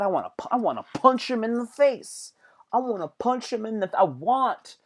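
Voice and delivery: worked-up, high-pitched threat; acted anger. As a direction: play someone itching for a fight. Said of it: "now I'm getting angry".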